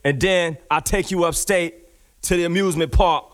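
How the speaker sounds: ordinary delivery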